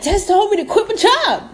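high-pitched mimicking of a listener; mock listener. Mimics listeners misquoting her advice.

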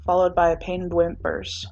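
ordinary delivery

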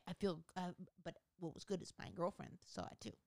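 normal talking voice

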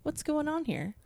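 high, soft mock protest; mock indignant. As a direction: play offended, mock pouty. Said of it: "I was like".

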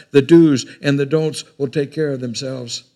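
loud, emphatic preaching; preaching emphasis. Acted. Preacher to congregation, a loud proclamation.

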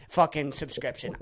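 usual speaking voice

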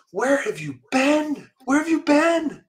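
high-pitched, drawn-out retold yell; very high, stretched-out mock holler. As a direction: imitate a lady yelling down the street. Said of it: "Why are you yelling at me".

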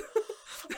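unvoiced high gasping laugh; high breathless laugh. Real, not acted. Hearing Alan Cumming's name, high breathless laugh. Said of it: "Oh, man".

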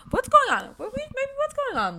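squeaky high questions; high playful voice. Pretend. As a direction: pitch up like a cartoon. Playful prompting of cohost.

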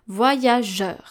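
"voyageur" said in French, as vwa-ya-zhuhr; French pronunciation. Shown as a demonstration. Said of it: "we pronounce je".

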